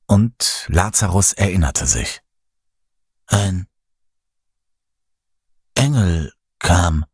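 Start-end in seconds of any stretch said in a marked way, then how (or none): 3.3-7.0 slow, broken by long pauses; halting. A narrator's reading. Lazarus recalls the angel, haltingly. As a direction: grope for each word, haltingly. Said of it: "sagte er stockend".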